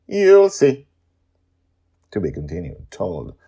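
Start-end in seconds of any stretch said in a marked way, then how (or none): 0.1-0.9 higher, louder knowing reply; sly teasing promise. Acted. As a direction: keep the secret playfully. Mysterious teasing answer.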